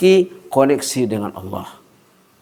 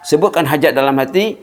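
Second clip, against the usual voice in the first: ordinary delivery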